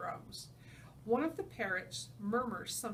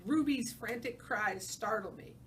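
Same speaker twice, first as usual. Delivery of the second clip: ordinary delivery, nothing marked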